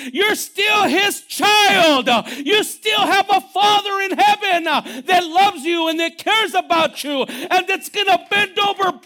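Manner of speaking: loud, high, crying-out sermon delivery; full-force preaching. Real, not acted. Preacher urging the congregation, loud and high.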